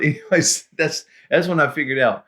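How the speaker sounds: ordinary delivery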